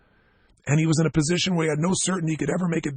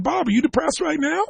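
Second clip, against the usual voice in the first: playful challenge; 11 semitones higher than usual. Acted. Teasing Bob before the audience.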